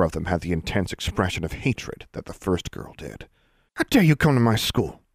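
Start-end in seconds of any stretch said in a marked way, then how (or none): 3.8-5.0 higher-pitched, indignant snarl; angry snarl. A narrator's reading. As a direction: snarl it at an intruder. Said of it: "snarled the blonde girl".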